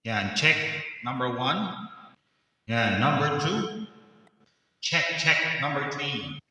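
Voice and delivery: mic-check count with echo; echo and reverb. Shown as a demonstration. Host testing the sound card's echo presets, reverberant mic-check voice. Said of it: "preset ng ating echo sa kanang ating reverb".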